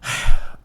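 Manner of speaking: loud, heavy exasperated sigh; fed up teacher sighing. Acted. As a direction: blow out air in frustration. Exasperated at mock student.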